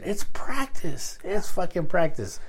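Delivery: It's practice said very slowly, each word leaned on; slow emphasis. Real, not acted. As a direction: spell out that It's fucking practice, slowly and firmly. Hammering home his point.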